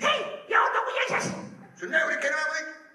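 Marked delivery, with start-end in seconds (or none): 0.0-1.3 squeaky, very high child imitation; even smaller girl. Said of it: "nog een kleiner meisje". 1.9-2.8 high, louder feigned innocence; mock denial. Acts Peter denying second time.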